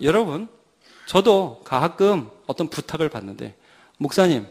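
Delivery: usual speaking voice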